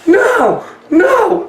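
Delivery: high shouted protest; crowd shout. Retold or imitated. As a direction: yell it like a stunned crowd. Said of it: "Everybody's like".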